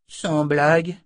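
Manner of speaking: high, doubtful; suspicious. A narrator's reading. Boy doubting the stranger, high and suspicious. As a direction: ask it with suspicion. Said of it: "de nouveau soupçonneux".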